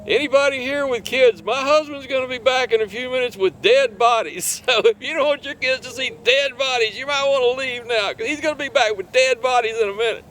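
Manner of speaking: high, loud call to the whole campground; retold shout to crowd. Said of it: "she shouts out to the crowd".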